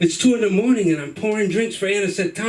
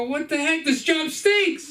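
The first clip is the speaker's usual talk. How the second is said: put-on kid shouting; 9 semitones higher, slower than usual. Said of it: "I go".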